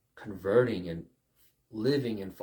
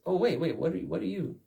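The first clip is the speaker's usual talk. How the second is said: raised-pitch startled challenge; acted official, higher and abrupt; challenging inspector; 7 semitones higher, faster than usual. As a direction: stop someone in their tracks. Inspector stopping an intruder, acted.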